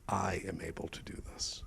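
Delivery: soft unvoiced whisper of the question. Shown as a demonstration. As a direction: breathe the words without voice. Emphasising the question word by word.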